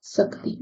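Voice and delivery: शक्ति said as "sakti", with s in place of sh, voiced very softly; teacher showing the common slip: शक्ति said as "sakti" with plain s; mispronounced shakti. Shown as a demonstration. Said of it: "और शक्ति को क्या कह देते हो?".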